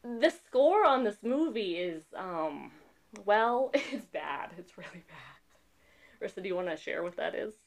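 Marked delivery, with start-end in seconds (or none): none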